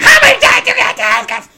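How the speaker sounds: high, loud angry yell; exasperated shout. Retold or imitated. Fed-up customer yelling at the counter, loud. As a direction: snap at the server. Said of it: "I'm standing there and I'm like".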